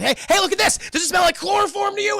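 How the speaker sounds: high, loud villain's ambush yell; acted shouting. Spellbinder ambushing Mister Cunningham with chloroform, high excited shout. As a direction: pounce on the victim with a high shout.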